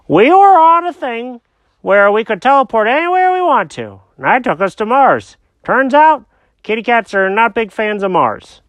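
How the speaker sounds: high-pitched confused cat; squeaky cartoon cat, lost and puzzled. Jenkins voice continued.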